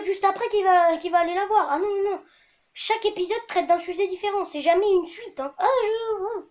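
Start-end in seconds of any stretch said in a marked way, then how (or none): none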